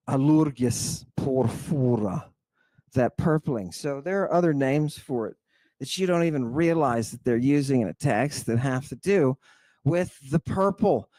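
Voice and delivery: ordinary talk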